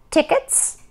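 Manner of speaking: high, perky rising query; chirping. A narrator's reading. Lady at the door asks mother, chirpy question. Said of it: "chirped the lady at the door".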